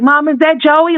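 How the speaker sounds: put-on high childlike question; little girl's question. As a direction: voice the small girl asking innocently. Voicing the little girl asking.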